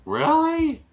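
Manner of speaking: high-pitched questioning surprise; surprised. Real, not acted. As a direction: react with real surprise, pitch shooting up. Reacts to the other person's correction.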